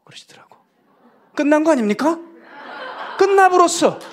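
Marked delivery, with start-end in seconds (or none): 1.4-4.0 much higher and louder, laughing in triumph; thrilled. Real, not acted. Preacher tells the congregation he has made it, laughing triumphantly. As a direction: crow it out like you've just won. Preacher exults over pastor's invitation.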